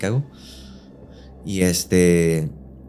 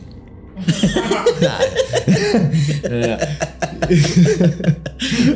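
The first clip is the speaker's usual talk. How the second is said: loud rising burst of laughter; long high laugh; 9 semitones higher, 4 dB louder than usual. Real, not acted. Laughing hard at his own ghost story. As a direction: cackle up high and let it ring.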